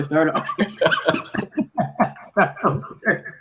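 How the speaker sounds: ordinary talk, nothing marked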